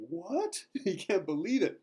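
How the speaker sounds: high, loud yelp of disbelief; astonished. Retold or imitated. Wallace hearing the king's banner has come, astonished. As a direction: blurt it out in stunned amazement. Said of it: "he just jumps up. He's like".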